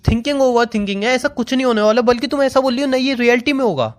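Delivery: high, hurt and heated pleading argument; heated hurt protest. Real, not acted. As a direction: argue back, wounded and worked up. Heated argument with leaving girlfriend.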